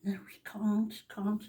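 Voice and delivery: hushed whisper. Retold or imitated. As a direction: whisper so she won't hear. Hiding answer from her on phone.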